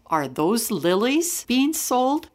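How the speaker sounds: usual speaking voice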